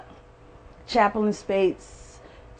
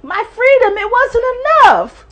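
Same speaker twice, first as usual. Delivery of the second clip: high, loud building cry of loss; grieving mother listing losses, building to a cry; rising lament; 9 semitones higher, 7 dB louder than usual. Real, not acted. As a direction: preach your grief to the rafters.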